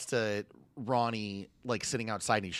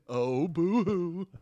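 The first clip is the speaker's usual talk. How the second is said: fake crying; 8 semitones higher, 5 dB louder, much slower than usual. Mocking a whiny complaint.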